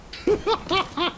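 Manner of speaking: short high-pitched laugh. Real, not acted. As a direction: burst out in a high giggle. Laughs at own remark.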